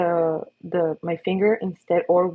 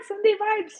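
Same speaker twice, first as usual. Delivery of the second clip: high pet talk; 13 semitones higher than usual. Real, not acted. Greeting the cat.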